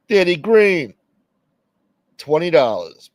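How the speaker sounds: high, loud call of the donor's name; excited shout-out. Real, not acted. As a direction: hype up the donor. Thanking a donor aloud.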